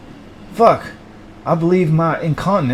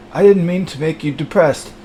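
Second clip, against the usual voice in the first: ordinary talk, nothing marked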